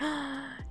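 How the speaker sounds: sharp, soft intake of breath; mock gasp. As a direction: gasp as if suddenly realizing. Acted shock at missing topper.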